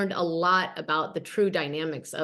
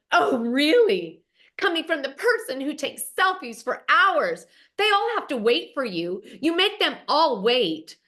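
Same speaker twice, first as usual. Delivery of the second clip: higher, sarcastic comeback; mock indignant retort; 6 semitones higher, 4 dB louder than usual. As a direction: snipe back in a retold huff. Camille fires back at Dorit.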